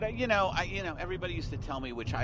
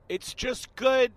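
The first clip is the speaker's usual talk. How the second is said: overeager; 11 semitones higher, 7 dB louder, slower than usual. Retold or imitated. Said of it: "I would say things to the casting person, like".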